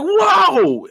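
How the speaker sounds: high drawn-out "whoa"; mock battle cry. Retold hero's cry.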